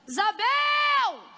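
high, long-held calling shout; drawn-out excited yell; acted shout. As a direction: holler the name as on arriving at a house. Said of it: "ela grita".

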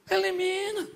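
high, sharp repeat of the word; emphatic echo. Acted. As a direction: snap the word back up high. Preacher answers his own line.

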